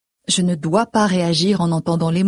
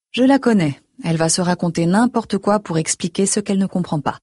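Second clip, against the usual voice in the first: plain reading voice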